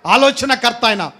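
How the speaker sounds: high, urgent preacher's appeal; fervent preaching. Acted. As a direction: press the congregation with each question.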